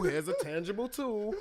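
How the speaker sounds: ordinary delivery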